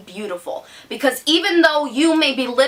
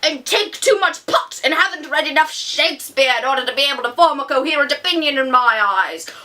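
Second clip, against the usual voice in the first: higher, snooty put-on voice of a stuffy grown-up; pompous adult critic; 4 semitones higher than usual. Teen vlogger mocking adult book critics, in a pompous, snooty voice. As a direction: sneer down your nose at the youth. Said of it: "the thing that I picture when people start talking like that is this".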